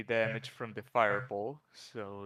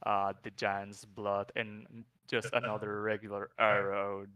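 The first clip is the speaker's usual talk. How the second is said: ordinary talk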